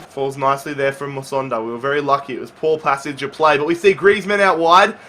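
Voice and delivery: usual speaking voice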